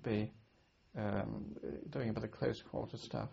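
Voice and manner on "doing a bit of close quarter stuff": normal talking voice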